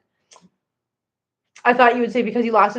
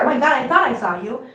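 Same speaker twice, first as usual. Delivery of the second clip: usual speaking voice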